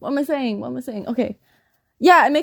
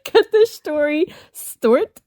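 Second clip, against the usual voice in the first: "Cut the story stort" with a northern vowel, slow and higher; laughing mock northern "Cut the story stort"; northern accent slip; 5 semitones higher, slower than usual. Shown as a demonstration. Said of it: "I said".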